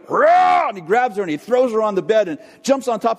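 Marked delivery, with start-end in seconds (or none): 0.1-0.7 much higher and louder mock roar; a boy roaring like a crocodile. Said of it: "So he goes".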